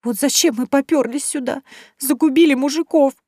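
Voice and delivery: put-on high, wailing girlish voice on the verge of tears; a young woman whining, close to crying; about to cry. Vasilisa mourns the men, high and tearful. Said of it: "лицо начало кривиться в приступе плача".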